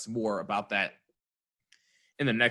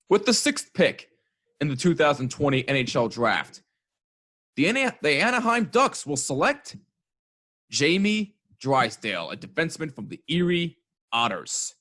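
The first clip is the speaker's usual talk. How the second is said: draft announcer; 4 semitones higher, 4 dB louder than usual. Acted. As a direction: announce the pick like the commissioner. Mock draft pick announcement.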